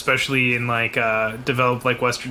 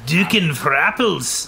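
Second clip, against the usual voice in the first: slow Scottish accent, a Shrek impression; 3 semitones higher, slower than usual. Shown as a demonstration. Copying a friend's line in a slow Scottish accent. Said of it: "good enough of a Scottish accent".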